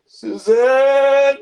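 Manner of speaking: loud, high drawn-out yell; hammed-up cry of betrayal; horrified yell. Werewolf seeing Susan's yellow eyes, horrified high yell. Werewolf cries out at glowing clown.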